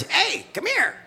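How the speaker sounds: very high parrot squawk. Acted. Parrot calling to a pet store customer, high squawk. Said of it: "Parrot says".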